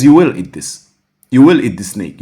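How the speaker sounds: insist, leave no room to refuse; firm insisting. Retold or imitated. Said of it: "He says".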